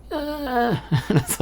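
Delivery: laughing. Real, not acted. Laughs through his own gremlin voice.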